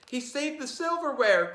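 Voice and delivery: overjoyed at the rescued silver; mother crying out. Acted. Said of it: "cried mother".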